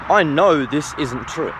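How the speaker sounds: normal talking voice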